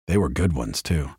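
ordinary delivery, nothing marked

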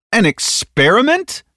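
high, slow, rising echo question; alarmed disbelief. Acted. Tatsumi now on the alert, alarmed. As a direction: query it in startled indignation.

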